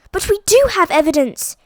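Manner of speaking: high, loud eager cry; cried. A narrator's reading. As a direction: cry out in triumph. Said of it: "cried Daisy".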